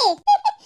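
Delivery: high, bursting teasing giggle. Acted. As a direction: giggle at her to tease her. Teasing about future mother-in-law.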